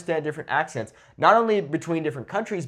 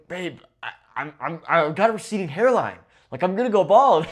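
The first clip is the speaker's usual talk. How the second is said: mock-distressed whine to a partner, building louder; complaining; 3 semitones higher, 4 dB louder than usual. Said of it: "I was complaining to my novia".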